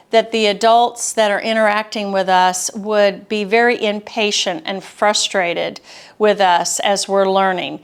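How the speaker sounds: normal talking voice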